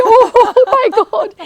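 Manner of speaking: high, loud laughing cry of delight; laughing exclamation. Retold or imitated. The teacher laughs at the toast, a loud laughing exclamation. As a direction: burst out laughing as you exclaim.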